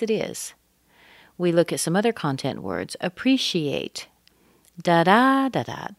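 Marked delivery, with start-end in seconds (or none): 3.0-4.1 slow "appreciate" with PRE lifted; stress pattern of appreciate. Shown as a demonstration. Teacher shows the word's pattern, stress on PRE. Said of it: "What kind of pattern". 4.8-5.9 second "Da" high and loud, the rest falling away; stress pattern in nonsense syllables. Shown as a demonstration. Teacher taps out the word's pattern, second syllable stressed. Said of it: "What kind of pattern".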